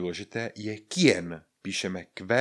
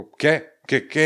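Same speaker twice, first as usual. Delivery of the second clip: louder, challenging question; 6 semitones higher, 8 dB louder, slower than usual. Acted. To someone staring at you, challenging question. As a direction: throw it back at someone staring. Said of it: "Někdo na vás čumí a vy na něj".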